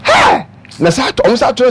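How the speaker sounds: high sudden yelled Hey; sudden shout. Retold or imitated. Host acting out festival gunfire panic, sudden shout. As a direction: burst out yelling as if startled by gunshots.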